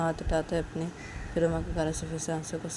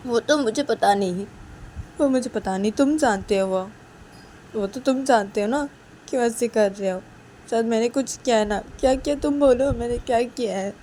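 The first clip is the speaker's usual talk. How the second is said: louder, insistent, hurt questioning; upset Uma; 6 semitones higher, 7 dB louder than usual. Acted. Uma demanding to know what she did, upset. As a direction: press for an answer, wounded.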